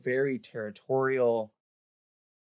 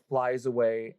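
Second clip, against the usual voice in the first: ordinary talk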